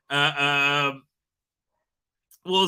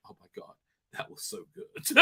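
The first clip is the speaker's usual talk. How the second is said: whispered through laughing; barely getting words out through laughter; airy delighted giggle; breathless laughter; 17 dB quieter than usual. Real, not acted.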